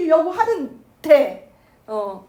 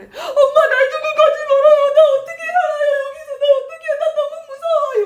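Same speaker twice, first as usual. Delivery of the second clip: put-on very high, loud, childlike sobbing plea; crying little girl; 12 semitones higher, 8 dB louder than usual. Five-year-old daughter begging her mother, very high and sobbing. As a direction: beg your mother not to leave you. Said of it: "다섯 살 숙향이 말을 해".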